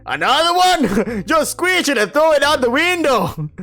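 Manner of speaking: loud, high shout with a laugh. Acted. Marrow shouts up to Cole through the walls, laughing. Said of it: "shouted to the thin walls".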